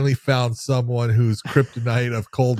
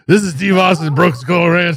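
lightly voiced gruff host intro; hammed-up TV host greeting; mock Steve Austin intro; 5 semitones higher, 6 dB louder than usual. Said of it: "He couldn't even get the lines out".

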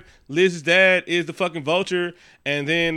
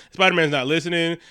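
ordinary delivery

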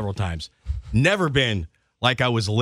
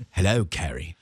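low, lightly voiced Australian wine label greeting; mock sultry label voice; 6 semitones lower than usual. The talking wine label greeting Carrie, low seductive voice. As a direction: purr the name like a hot label. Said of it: "he was like".

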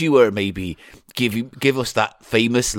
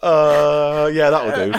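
laughing; 7 semitones higher, 4 dB louder, slower than usual. Real, not acted. Host laughing after the catchphrase.

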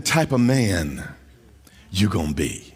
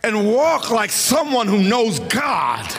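full-force preaching; 11 semitones higher than usual. Acted. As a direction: belt it over the organ, triumphant. Preacher climaxes over organ.

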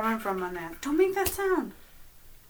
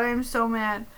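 ordinary talk

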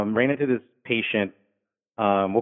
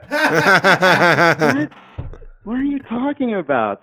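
incredulous; 8 semitones higher, 6 dB louder, much slower than usual. Retold or imitated. Reacting to absurd drunk quote.

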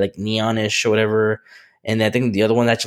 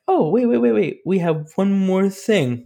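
teasing company presenter; 10 semitones higher, slower than usual. Retold or imitated. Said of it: "he can come out and be like".

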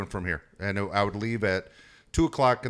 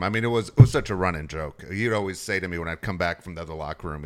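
ordinary talk, nothing marked

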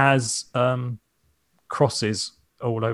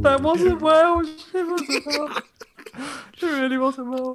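high laughing protest; 14 semitones higher, slower than usual. Real, not acted. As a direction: giggle through the words. Laughing at a failed accent.

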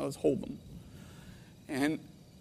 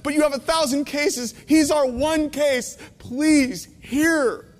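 raised, higher pleading voice, slowing at the end; pleading plea to the court; 6 semitones higher, 3 dB louder than usual. Retold or imitated. Father pleading with the judge for his son, raised and higher. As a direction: beg the court to really hear him. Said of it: "I want to stand up and say".

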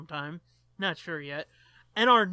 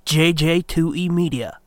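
announcer; 4 semitones lower, 10 dB louder than usual. Acted. Closing announcer read.

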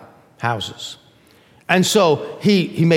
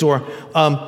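ordinary delivery

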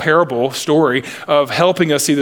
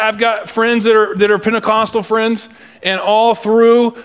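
ordinary delivery

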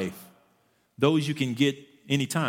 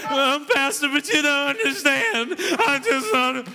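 high, whiny stalling voice; mock whiny excuse to the pastor; whining churchgoer; 13 semitones higher, 5 dB louder than usual. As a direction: whine your way out of it. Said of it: "when you whining".

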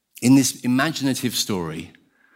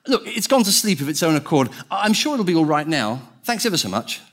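higher, protesting ghost voice; flustered ghost; 6 semitones higher than usual. Acted. Ghost objecting to the angel's offer to kill, flustered. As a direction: voice a ghost backing out, pitched up.

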